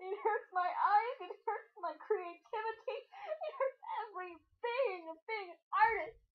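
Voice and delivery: high, pained mock crying voice; mock anguish. Host mock-wailing at the ugly fan art, pained. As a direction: wail it like the drawing physically hurts.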